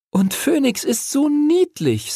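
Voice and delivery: put-on very high, gushing girl's voice; enraptured. A narrator's reading. Chloe gushes over cute Phönix, delighted. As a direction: gush over the cute little dragon. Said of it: "sagte Chloe verzückt".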